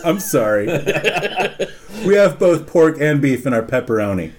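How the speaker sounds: low, composed phone reply; customer-service apology. Retold or imitated. Worker answering phone customer, low composed apology. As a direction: reenact a measured answer to a customer.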